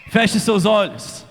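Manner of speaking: ordinary talk, nothing marked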